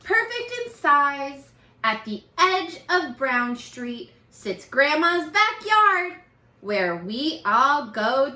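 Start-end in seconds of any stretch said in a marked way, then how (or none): none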